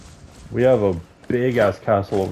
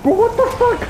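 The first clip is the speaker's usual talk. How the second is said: shrieking. Real, not acted. Shock at the boars.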